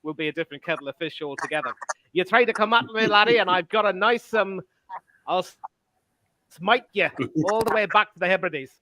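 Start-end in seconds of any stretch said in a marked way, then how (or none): 2.1-8.7 hammed-up Scots threat, raised pitch; mock-fierce, badly faked Scottish accent; awful Scottish accent. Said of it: "that was an awful accent".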